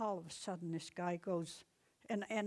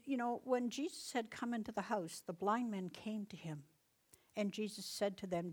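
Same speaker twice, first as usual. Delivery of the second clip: normal talking voice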